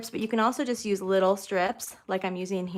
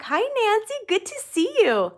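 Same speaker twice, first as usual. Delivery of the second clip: excited greeting; 12 semitones higher, 4 dB louder than usual. Real, not acted. Greeting a live viewer.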